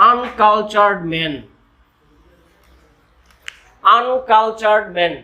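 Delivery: আনকালচার্ড ম্যান said slowly, opening with a short "un" sound, not "you"; teacher slowly modelling আনকালচার্ড ম্যান for the class; slow pronunciation demo. Shown as a demonstration. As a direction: sound out আনকালচার্ড ম্যান deliberately so the start is heard as "un". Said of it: "উচ্চারণটা কিসের মতন?".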